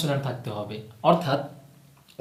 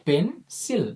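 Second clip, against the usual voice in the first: "Pencil" higher, split as পেন, pause, সিল; syllable by syllable; 5 semitones higher, much slower than usual. Shown as a demonstration. Teacher shows it is pronounced twice, "pencil" as পেন... সিল. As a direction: give "pencil" two separate pushes. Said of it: "কারণ এটি দুবার উচ্চারিত হচ্ছে".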